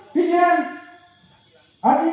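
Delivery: loud high command calls; game leader calls out the commands; called commands. Real, not acted. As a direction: bark each command to the group.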